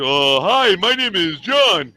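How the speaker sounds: loud pointed introduction. Retold or imitated. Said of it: "or I said".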